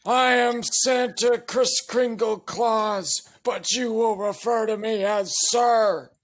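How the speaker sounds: high, slow drill sergeant voice. Acted. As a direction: announce yourself like a drill instructor. Drill sergeant addresses recruits.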